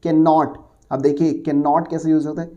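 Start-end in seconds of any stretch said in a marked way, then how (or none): none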